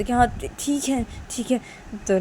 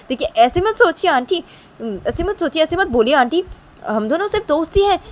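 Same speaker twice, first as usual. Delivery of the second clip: friend protesting, higher and louder; सफ़ाई देता दोस्त; 10 semitones higher, 7 dB louder than usual. Acted. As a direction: insist to auntie she has it wrong. (ऊँची आवाज़ में aunty को सफ़ाई देता दोस्त)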